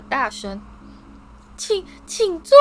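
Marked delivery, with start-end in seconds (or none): 1.6-2.6 high wailing elf, slow and loud; crying out. A narrator's reading. Dobby weeps at being asked to sit, high loud wail. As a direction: wail it through tears. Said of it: "他哭叫道".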